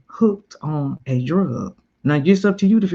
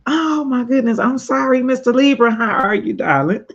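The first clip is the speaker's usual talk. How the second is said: gushing greeting; 9 semitones higher, 4 dB louder than usual. Real, not acted. Greeting a viewer in chat.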